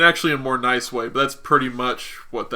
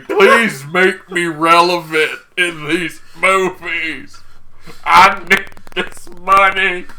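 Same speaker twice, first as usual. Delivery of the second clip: loud, high, drawn-out begging; desperate comic wail; mock screaming plea; 5 semitones higher, 7 dB louder, slower than usual. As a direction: beg at a hammed-up yell, slowly. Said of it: "grabbing onto his shirt and screaming".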